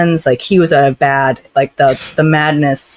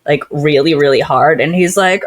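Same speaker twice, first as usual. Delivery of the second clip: ordinary talk, nothing marked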